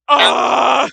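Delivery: a loud sudden shriek of a character in fear; terrified scream. Acted. Deadbolt shrieks at the slime, loud and terrified. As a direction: scream bloody murder. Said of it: "Deadbolt screaming bloody murder".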